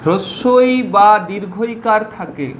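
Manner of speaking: slow, measured phrases with long pauses; teacher's dictation. Acted. Teacher giving students rule two to write, slow dictation. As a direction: dictate it piece by piece, repeating phrases.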